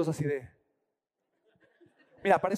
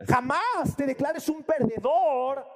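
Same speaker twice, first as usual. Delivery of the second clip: motivational; 6 semitones higher, 5 dB louder, much slower than usual. Retold or imitated. Friend preaching motivation.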